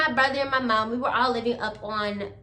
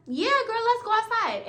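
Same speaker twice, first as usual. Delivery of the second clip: much higher, bright eager yes; eager agreement; 8 semitones higher than usual. Retold or imitated. Answering her best friend's call, eagerly higher. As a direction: jump at the invite.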